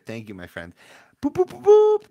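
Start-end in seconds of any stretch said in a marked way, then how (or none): 1.2-2.1 very high, very loud train toot; piercing high hooting; screamed boop. Pretend. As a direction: shriek it like a train whistle. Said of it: "I'm screaming boop".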